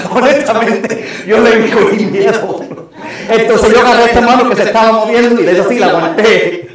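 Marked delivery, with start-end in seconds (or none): 0.1-2.6 laughing through the words, louder. Real, not acted. Preacher laughing at his own fear to congregation. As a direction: laugh out the confession.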